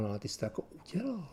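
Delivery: very soft, breath-only inner voice; whispered self-accusation. Retold or imitated. Man telling himself he did it, whispered. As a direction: whisper it to yourself as guilt dawns. Retold inner admission of guilt.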